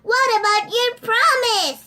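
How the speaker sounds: high, loud, indignant call; calling after her. Acted. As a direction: yell it after the fleeing princess. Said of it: "the frog called after her".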